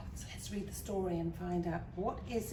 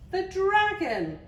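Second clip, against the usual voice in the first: high, loud, drawn-out announcement; excited reveal; 11 semitones higher, 9 dB louder, slower than usual. A narrator's reading. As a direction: exclaim it as a big surprise for children. Excited reveal of the returning dragon.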